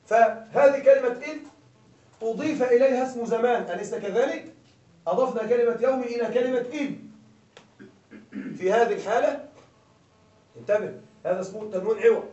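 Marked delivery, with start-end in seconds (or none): none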